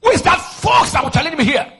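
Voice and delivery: high, bold preacher's challenge; talking boldly. Shown as a demonstration. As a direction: show them how to talk boldly. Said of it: "Don't you see the way I talk?".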